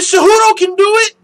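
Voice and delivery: high, loud mocking troll voice. Mocking a chat troll's argument, loud and high-pitched. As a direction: mimic the troll's dumb claim. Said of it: "He said".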